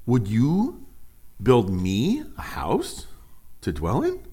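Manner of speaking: slow, drawn-out questioning with steep rises; incredulous divine question. Acted. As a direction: stretch it out as God's pointed question. Reads God's challenge to David from scripture.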